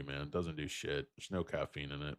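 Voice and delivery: usual speaking voice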